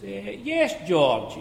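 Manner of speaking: pompous under manager answering; mocking manager voice. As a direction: voice the pompous boss.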